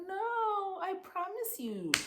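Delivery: very high, soft imitated plea; mock-earnest high whine; pleading protest. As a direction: protest innocence in a squeaky little voice.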